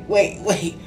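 ordinary talk

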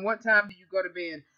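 ordinary talk, nothing marked

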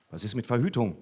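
ordinary talk, nothing marked

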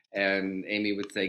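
ordinary talk, nothing marked

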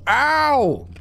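long, high ow; pained yelp. Real, not acted. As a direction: cry out suddenly mid-sentence. Sudden pain cuts off sentence.

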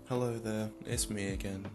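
ordinary talk, nothing marked

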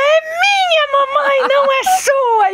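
put-on very high, slow child's protest; jealous toddler. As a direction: squeal it like a possessive little boy. Filho imitado brigando pela mãe. (voz aguda de criança ciumenta)

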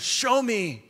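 high, loud order to a pupil; acted sudden commanding shout; master's command. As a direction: call it out like a martial-arts master.

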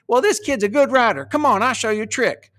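high-pitched cowboy voice; high, Ross Perot-like cowboy; High Beckman. Shown as a demonstration. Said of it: "I had High Beckman, uh, up like this".

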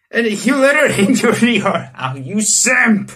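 loud, laughing mock scolding; loud laughing you simp; laughing tease. Real, not acted. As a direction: laugh at him and call him out. Teasing the hero, laughing.

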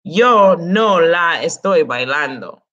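"Yo no la estoy bailando" slowed, opening "Yo" stressed; slow model sentence. Shown as a demonstration. Teacher models subject-first order for class, slow and deliberate. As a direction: land hard on "Yo". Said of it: "subject before the no".

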